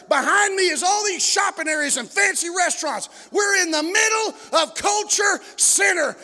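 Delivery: driving preaching. Real, not acted. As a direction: hammer it out to the congregation, stretching the big phrases. Preacher builds to full force.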